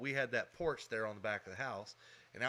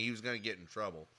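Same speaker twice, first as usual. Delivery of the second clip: usual speaking voice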